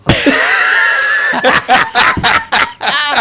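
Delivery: big loud burst of laughter; howling laugh at his own joke; loud laughing shout. Real, not acted. Joke about Villy.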